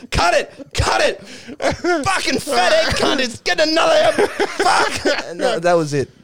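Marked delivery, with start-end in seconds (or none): 0.1-5.0 high, shouted exasperation; mock explosive outburst. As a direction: yell cut in hammed-up fury. Acted producer explodes, ending the show.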